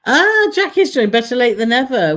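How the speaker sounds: high, loud happy welcome; excited greeting. Real, not acted. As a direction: cheer a friend arriving. Greeting a newcomer.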